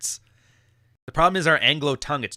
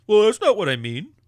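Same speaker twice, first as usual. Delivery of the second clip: higher, louder mock protest; mock whiny guy; 6 semitones higher, 7 dB louder than usual. Mocking a defensive guy objecting to the podcaster. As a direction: sneer it like a defensive dude.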